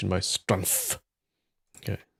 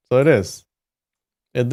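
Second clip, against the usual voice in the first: usual speaking voice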